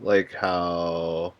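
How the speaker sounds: ordinary delivery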